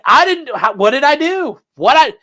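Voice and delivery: high, loud, indignant protest; MadTV lady impression. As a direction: protest in a high, incredulous yelp. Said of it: "he's like that lady on Mad T V".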